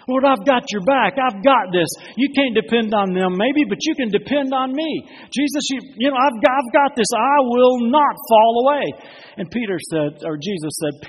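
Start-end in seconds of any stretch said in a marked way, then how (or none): 0.1-8.9 loud, high, boastful protest; proud boast. Acted. Peter boasting to Jesus, loud and proud. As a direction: puff up and brag to the Lord. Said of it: "a little bit of pride in Peter".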